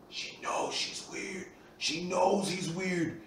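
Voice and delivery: slow hushed whisper to a friend in the cinema. Retold or imitated. Friend whispering to Zach during the movie, hushed. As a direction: lean over and breathe it, slowly.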